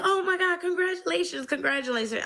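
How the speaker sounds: high, thrilled congratulating; a friend squealing congratulations at the news; excited congratulations. Retold or imitated. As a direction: gush it out like a delighted friend. Nurse friend cheering her news.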